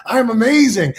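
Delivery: high, exultant affirmation; really feeling it. Shown as a demonstration. Leading listeners to say it along, exultant. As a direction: proclaim it so they join in. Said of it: "Really feel it now".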